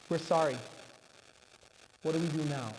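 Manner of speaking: low, soft and contrite; acted humbled helplessness; subdued apology. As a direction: drop the voice, sheepish and lost.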